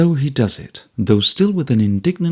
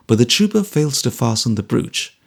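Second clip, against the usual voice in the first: usual speaking voice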